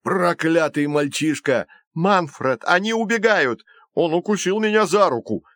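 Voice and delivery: high, agitated yelling; alarmed, angry cries in a scuffle; furious shouting. A narrator's reading. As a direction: shout in outrage, pitch right up. Villains shouting as children escape.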